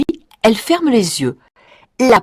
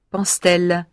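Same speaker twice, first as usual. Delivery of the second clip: plain reading voice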